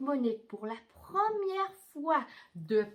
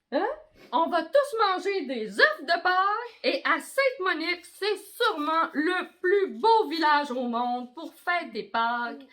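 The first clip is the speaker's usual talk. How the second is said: festive mayor; 6 semitones higher, 8 dB louder than usual. Acted. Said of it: "mon oncle Mick, le maire, dit aux amis".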